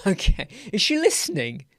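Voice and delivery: ordinary talk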